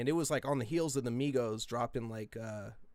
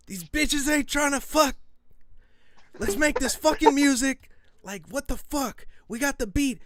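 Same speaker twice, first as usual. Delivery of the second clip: high, loud frantic rant; retold screaming; 13 semitones higher, 8 dB louder than usual. Yelling at the girls at the party, high and loud. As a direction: yell it out, pitched way up. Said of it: "screaming fucking just some crazy ass out of pocket shit".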